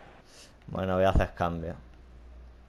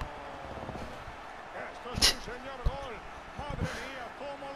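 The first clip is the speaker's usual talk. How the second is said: long laugh; 13 dB quieter than usual. Real, not acted. Laughing at the match.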